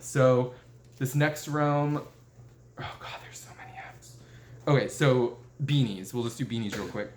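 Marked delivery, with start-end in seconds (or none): 2.8-4.2 a soft, breathless aside of mock despair; hushed, frazzled whisper to herself; panicked whisper. Real, not acted. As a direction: whisper it to yourself, overwhelmed. Panicking about running long.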